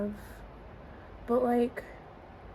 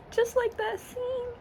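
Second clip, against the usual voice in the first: mock sob. Mock crying over the scene.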